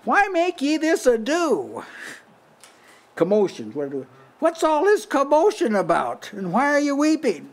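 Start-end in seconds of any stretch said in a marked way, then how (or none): 0.1-1.8 Jesus's voice. Retold or imitated. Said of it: "He said". 4.4-7.5 Jesus's voice. Retold or imitated. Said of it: "He said".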